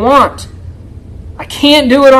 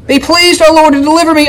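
usual speaking voice

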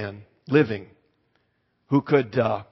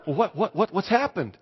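higher, stammering astonishment; flustered surprise; 5 semitones higher than usual. Retold or imitated. Pastor to the delighted lady over tea, flustered. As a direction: stumble over the question in mock bewilderment. Startled pastor asks after the gushing.

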